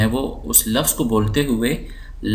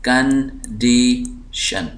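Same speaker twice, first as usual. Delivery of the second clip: Condition very slow, three separate syllables; much slower than usual. Shown as a demonstration. Teacher dividing the word for students, slow split syllables. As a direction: break condition into कं, डी, शन with pauses. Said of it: "हम जो ज़ोर दे रहे हैं".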